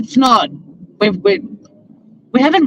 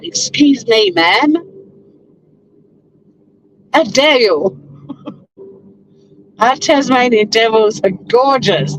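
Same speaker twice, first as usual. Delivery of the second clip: hammed-up offended gasp of a proud local; mock indignation; 5 semitones higher than usual. Proud Tasmanian mock-scolds the podcaster over devils, in hammed-up indignation. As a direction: playfully scold her, pitched up in fake outrage.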